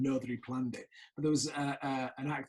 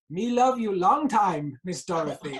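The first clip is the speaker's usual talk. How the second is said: slow, loud, lightly put-on Chinese accent in broken English; cheeky slow Chinese accent, louder, playing to the cast; mock Chinese accent; 4 semitones higher, 8 dB louder, slower than usual. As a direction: ham up a mild Chinese accent and stretch "love" slowly. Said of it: "in this broken English, in a Chinese accent".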